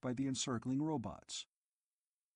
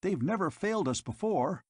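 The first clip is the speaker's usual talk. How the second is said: high, louder frightened question; asked shrilly; 6 semitones higher, 4 dB louder than usual. A narrator's reading. Dodeth asking the keepers in fright, shrill and high. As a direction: panicky pitch up. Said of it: "he asked shrilly".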